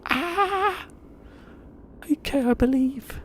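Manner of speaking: yelp, then squeak out a frightened conversion; mock startled shriek. Pretending the lightning bolt scares him into believing, in a high squeal. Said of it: "I'd be like".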